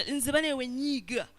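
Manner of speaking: slow, mock-tough boast; lightly voiced macho bragging; boasting angry guy. A guy brags to friends about his temper, in a slow, mock-tough boast. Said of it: "I've seen guys who be".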